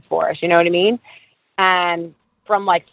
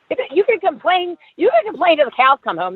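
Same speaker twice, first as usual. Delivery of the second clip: pitched up high, exasperated; 8 semitones higher than usual. Real, not acted. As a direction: throw up your hands in disbelief. Exasperated about complaining.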